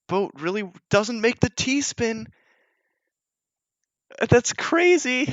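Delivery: high, quick esports casting; rising with every misdrop, near shouting at the big moments; excited play-by-play. Acted. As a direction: call the action like a hyped caster. Live casting of the match.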